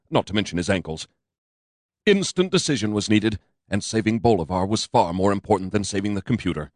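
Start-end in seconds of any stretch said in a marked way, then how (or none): none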